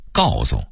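"诉"念成"送"，低沉收尾; 北京腔说"告送"; Beijing pronunciation. Shown as a demonstration. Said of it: "北京人却念送". (北京话变音念"告送")

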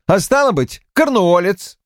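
high, triumphant clincher; insistent. A narrator's reading. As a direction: land the point triumphantly. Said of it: "гнул своё Полвард".

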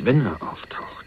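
slow, soft whisper, breathed out; menacing whisper. Acted. Telling his cellmate what the brother plans, in a slow whisper. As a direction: let the threat hang in a hushed whisper. Hinting at the brother's murder plan.

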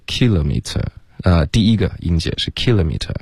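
kilometer stressed on the first syllable; British kilometer, punch the KIL. Shown as a demonstration. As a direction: say kilometer as "KIL-uh-mee-tuh". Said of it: "如果是英式的口音，它的重音是".

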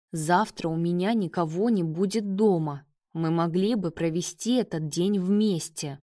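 plain reading voice

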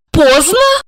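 "поздно" as a high rising question; high female voice, astounded; stunned echo. A narrator's reading. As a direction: echo it in shocked disbelief. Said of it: "ошеломлённо посмотрела на Георгия и переспросила".

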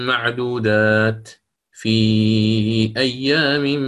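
slow, melodic tajweed recitation; Quran recitation. Acted. Teacher recites verses to students in melodic chant. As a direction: draw out each phrase in chant.

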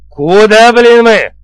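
loud, high, angry bark; barked rebuke. A narrator's reading. Chief dragging both men back, barking. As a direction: snap it out at full voice. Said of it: "рявкнул я".

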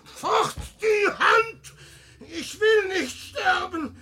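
panicked, high shouting; terrified shouting. Acted. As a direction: scream in terror, begging to live. Peer wehrt den Fremden ab. (verzweifeltes Schreien)

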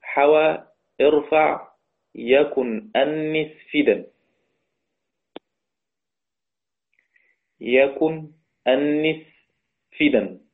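very slow, drawn-out chant of the verse; slow verse recitation. Shown as a demonstration. Teacher reading the second verse line aloud to students, very slow chant. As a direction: intone حوى ارفع يكن أنس slowly. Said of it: "نقرأ كده البيت الثاني".

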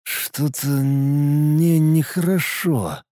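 slow, soft, slurred mumbling; very slow, drugged mumble; woozy mumble. A narrator's reading. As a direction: drag the words out as if about to pass out. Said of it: "вдруг промямлил".

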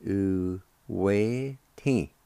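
"U-we-ti" drawn out very slowly, syllable by syllable; very slow sounding out. Shown as a demonstration. Teacher slowly sounding out the word for learners. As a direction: stretch "U-we-ti" slowly for beginners. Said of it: "It's pronounced like this".

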